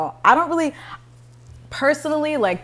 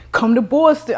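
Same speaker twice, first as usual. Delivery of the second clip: put-on higher-pitched, amateur Boston accent; attempted Boston accent; 6 semitones higher, 4 dB louder than usual. Woman quoting a man from Boston, attempted Boston accent. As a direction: have a clumsy go at a Boston accent. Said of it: "I don't know the accents".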